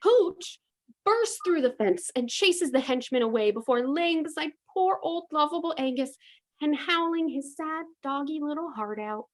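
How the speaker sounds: ordinary delivery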